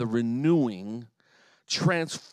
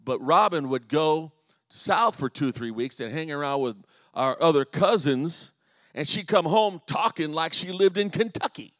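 ordinary talk

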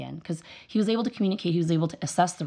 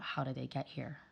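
hushed and lower, puzzled head-shake tone; disapproving inner question; 4 semitones lower, 13 dB quieter than usual. Retold or imitated. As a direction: ask it very quietly, baffled by bad parenting. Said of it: "every time I saw bad parental behavior".

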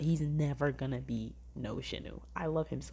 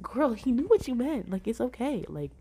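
exasperated sass; 7 semitones higher than usual. Retold or imitated. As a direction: talk to the screen like a friend who is overthinking it. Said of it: "I was like".

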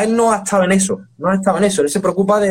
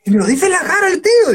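much higher put-on voice, amused friend; imitating Bustamante laughing; 14 semitones higher than usual. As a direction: mimic a friend laughing at being told the truth. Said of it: "y se harta de reír". (imitando a un amigo que se ríe, voz mucho más aguda)

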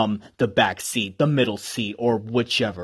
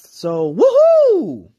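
high, stretched-out woohoo; excited cheer; 7 dB louder, much slower than usual. Real, not acted. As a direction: let out a slow, high cheer. Cheering his own graduation.

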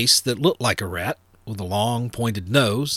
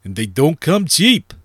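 louder, higher-pitched protest; complaining; 4 semitones higher, 6 dB louder than usual. A narrator's reading. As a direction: balk at a costly rush job. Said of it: "he complained".

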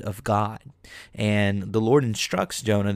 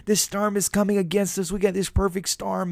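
high-pitched imitation of panicked disciples; mock freaking out; 10 semitones higher than usual. Disciples wake Jesus in the storm, high mock panic. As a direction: whimper it in a high, hammed-up panic. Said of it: "the disciples are freaking out".

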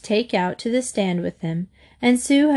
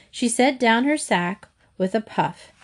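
usual speaking voice

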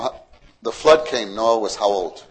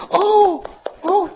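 much higher laughing gasp of shock; shocked mother; 3 dB louder than usual. Acted. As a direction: gasp in horror at the news.

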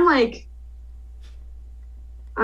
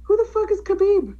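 baffled; 10 semitones higher than usual. Real, not acted.

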